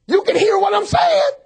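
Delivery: loud and high, driving it home; preacher shout. Real, not acted. Preacher to congregation, ringing shout. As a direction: shout it out to the church.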